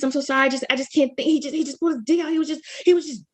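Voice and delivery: imitating her shaken call to the operator; shaken, flustered. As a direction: blurt it out in shock. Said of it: "I don't normally talk like this".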